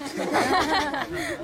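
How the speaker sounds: real high laughter at the punchline; laughing. Real, not acted. Speaker laughs at his own Rottweiler joke to the group. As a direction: burst out laughing.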